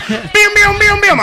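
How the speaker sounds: high, loud bim bim punches; punch sound effects. Pretend. Acting out a pub fight, high mock punch noises. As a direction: mimic punches landing with your voice.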